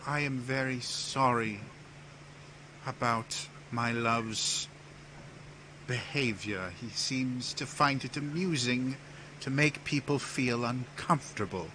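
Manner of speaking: lightly voiced gracious Empress; apologetic Empress. Empress apologizes to guests for her love, gracious.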